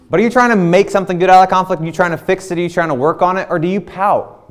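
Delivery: high, loud, fast challenge; fired-up preaching push. Real, not acted. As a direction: press the congregation hard. Preaching climax after laughter.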